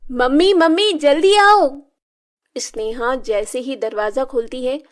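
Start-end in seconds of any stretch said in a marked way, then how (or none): 0.1-1.8 loud, high call for mother. Acted. Sneha shouting for her mother from the door, loud and high. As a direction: shout for mum urgently. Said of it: "वो चिल्लाकर अपनी माँ को बुलाती है".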